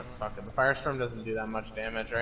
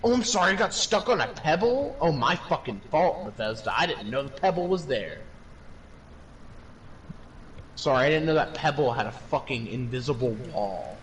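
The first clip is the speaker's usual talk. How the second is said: sarcastic rant; 5 semitones higher than usual. Real, not acted. Ranting at the game's bugs.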